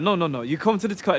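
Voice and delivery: mock French rant. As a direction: rattle it off like an offended baker. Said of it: "he's like".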